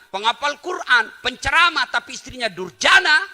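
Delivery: loud, high preaching at full force; fiery preaching. Acted. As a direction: drive it home to the congregation.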